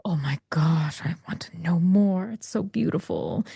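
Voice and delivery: hushed, breathless whisper of wonder; awed whisper. Retold or imitated. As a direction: whisper it like seeing treasure for the first time. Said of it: "And then you go".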